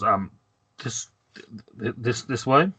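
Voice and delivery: stammering, unsure guard; timid. Acted. Guard showing visitors in, timid and stammering. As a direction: falter nervously through each word. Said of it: "he kinda timidly looks around and goes".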